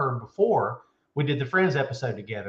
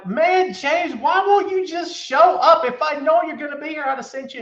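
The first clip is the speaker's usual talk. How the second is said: higher pitched playful scolding; high excited callout; 11 semitones higher, 6 dB louder than usual. Real, not acted. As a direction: call him out, high and loud. Calling out a viewer.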